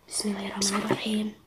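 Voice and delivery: whispered prayer before eating; whispering. Real, not acted. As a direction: hush the blessing. Whispered prayer before first bite.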